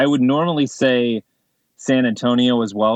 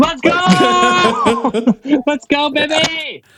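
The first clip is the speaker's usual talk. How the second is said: excited cheer; 13 semitones higher, much slower than usual. Real, not acted. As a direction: whoop it up. Celebrates OKC being worst.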